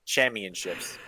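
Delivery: "Championships" low and quiet, as cham-yun-ships; deadpan mimicry of "Championships" with no P; imitated mispronunciation. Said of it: "No, he never does".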